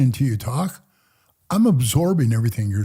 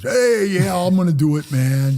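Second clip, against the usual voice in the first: higher, louder gung-ho recruit; mock eagerness; 6 semitones higher, 4 dB louder than usual. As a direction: imitate an overeager yes. Imitating eager recruits accepting the danger.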